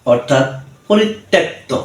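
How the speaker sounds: usual speaking voice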